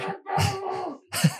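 high hammed-up sob; mock sobbing. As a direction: sob like a ruined fan. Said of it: "going through these phases of grief".

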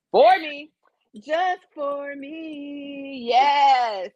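very slow, drawn-out savoring. Real, not acted. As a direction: stretch each word, relishing. Claiming the men dreamily.

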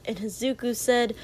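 ordinary delivery, nothing marked

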